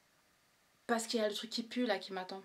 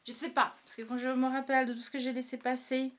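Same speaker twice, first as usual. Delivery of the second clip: ordinary talk, nothing marked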